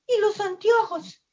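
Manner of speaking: startled mother's cry, leaping high on the question. A narrator's reading. Manatee mother spotting her Oscar without glasses, crying out. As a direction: call out his name, then a high-pitched demand. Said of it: "La mamá hasta que gritó cuando lo vio".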